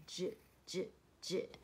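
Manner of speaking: j j j as separate soft-g sounds. Shown as a demonstration. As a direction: say j alone, like the end of huge. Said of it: "字尾的G E是念". (j j j，一个一个分开念)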